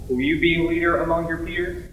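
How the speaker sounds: ordinary talk, nothing marked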